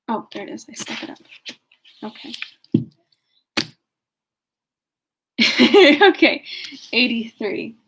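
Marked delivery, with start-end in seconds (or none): 2.0-6.4 high burst of real laughter; giggling okay; laughing. Real, not acted. Laughs at own muddle.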